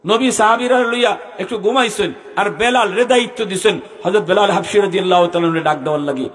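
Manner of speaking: ringing storytelling from the pulpit; impassioned sermon narration. Real, not acted. Preaches story in raised voice.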